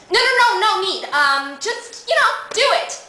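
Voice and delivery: high, loud, panicky refusal; flustered protest. Acted. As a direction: blurt it out to head him off. Acted panic at being looked at.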